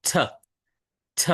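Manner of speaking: "থ" as a lone aspirated t, tongue tip at the base of the upper teeth; t sound. Shown as a demonstration. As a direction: tap the tongue behind the upper teeth and puff out "থ". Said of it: "জিহ্বার সামনের অংশটা".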